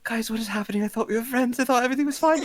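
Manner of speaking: high, tearful pleading voice; distraught pleading. Acted. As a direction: plead with friends, voice near breaking.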